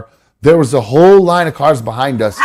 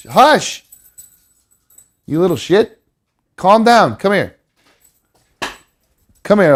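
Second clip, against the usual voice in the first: scolding the dog; 7 semitones higher than usual. Real, not acted. Hushing the dog.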